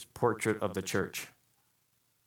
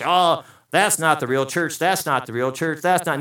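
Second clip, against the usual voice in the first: mock dismissal; 5 semitones higher, 7 dB louder than usual. Mocking critics of other churches.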